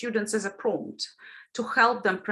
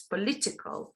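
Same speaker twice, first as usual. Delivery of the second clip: POLITICAL with the weight on LIT, first syllable weak; stress on second syllable; 2 semitones lower, 7 dB quieter than usual. Shown as a demonstration. Teacher showing stress shift in a word family, political stressed on LIT. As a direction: move the beat along: poLITical. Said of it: "need to shift the stress".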